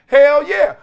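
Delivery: loud high hell yeah; emphatic yell. Real, not acted. Host answering his own question with a loud hell yeah. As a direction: shout the answer out proudly.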